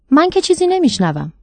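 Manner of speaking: lightly voiced boy. A narrator's reading. Boy tells the host he hears nothing, lightly childlike and puzzled.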